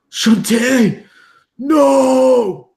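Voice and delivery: high, loud grief-stricken wail; anguished wail. Acted. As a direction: cry out his name in despair. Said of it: "you hear him wail".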